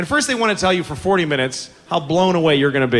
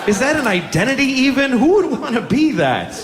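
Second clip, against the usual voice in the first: disgusted disbelief; 7 semitones higher than usual. Retold or imitated. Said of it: "I'm like".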